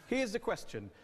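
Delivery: normal talking voice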